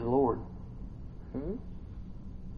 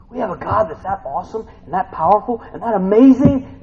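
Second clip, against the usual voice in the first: high, loud, mounting praise; excited preaching; 7 semitones higher, 8 dB louder than usual. Real, not acted. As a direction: hammer each word of praise louder. Preaching at full force.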